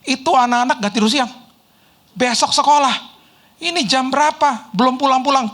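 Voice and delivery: high-pitched mock scolding; retold nagging, pitched well up; imitating angry maid. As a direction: tell the boss off like a cross nanny. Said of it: "Terus dia, dia marah-marah".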